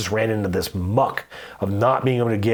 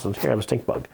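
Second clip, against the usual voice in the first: deadpan repeated bluff; 5 dB quieter than usual. Retold or imitated. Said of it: "I do it so repetitively".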